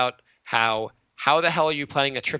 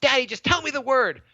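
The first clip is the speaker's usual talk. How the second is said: put-on whiny child; 9 semitones higher than usual. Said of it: "he's just like".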